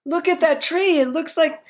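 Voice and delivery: put-on high, whiny child pleading; super whiny kid voice. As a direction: whine like a kid wanting a stop. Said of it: "aren't I convincing with my super whiny voice".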